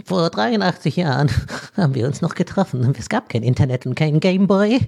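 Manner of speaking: a hint of a rambling grandpa. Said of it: "ich klinge jetzt wie so ein Opa".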